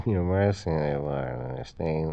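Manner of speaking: low, soft sleep mumble; talking in sleep. Acted. Husband dreaming beside his wife, sleep-talking. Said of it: "talking in your sleep and giggling".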